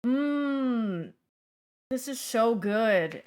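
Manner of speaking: lightly mocked, slow "Mm... this is so good"; classic influencer line. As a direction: savor it like a fake food review. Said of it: "Oh, this is the classic".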